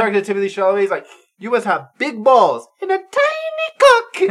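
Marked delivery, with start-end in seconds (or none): 1.4-4.3 loud, high, drawn-out French caricature; hammed-up awful French accent, stretching the last words; terrible French accent. Imitating Robert Pattinson taunting Timothée, absurdly bad French accent. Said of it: "he has the worst French accent".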